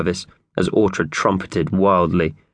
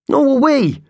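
much higher, louder and cheerful; brightly; 4 dB louder than usual. Acted. Mickey Thompson reassures Howard, much higher and louder. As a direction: chirp it eagerly. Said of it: "said Mickey Thompson brightly".

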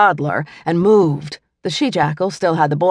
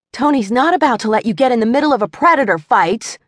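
a hint of a bossy teenage sister, higher; lightly voiced teen girl; 6 semitones higher than usual. A narrator's reading. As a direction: warn her little brother off. Teenage sister scolds brother.

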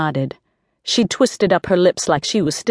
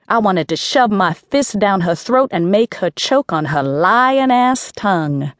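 ordinary delivery, nothing marked

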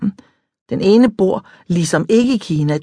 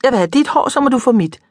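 put-on higher girlish demand; lightly voiced girl; 6 semitones higher than usual. A narrator's reading. Mariam at the mirror, lightly eager girl voice.

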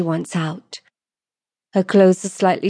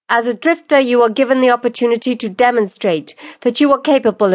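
raised, louder formal proclamation; stern lordess pronouncing law; 5 semitones higher, 4 dB louder than usual. Acted. Lordess reading the law to the accused, formal and forceful. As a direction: proclaim the law to a whole courtroom.

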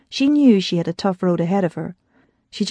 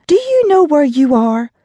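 higher, louder accusing demand; 7 semitones higher, 5 dB louder than usual. Acted. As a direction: demand it, hands on hips. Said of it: "she demanded".

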